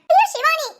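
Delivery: ordinary talk, nothing marked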